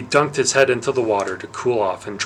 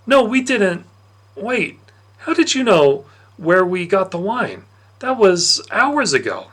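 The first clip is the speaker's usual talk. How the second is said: put-on high, startled boy's voice protesting; a boy caught out, denying then baffled; caught young Patroclus; 8 semitones higher, 4 dB louder than usual. Patroclus caught stealing wine, a high startled denial.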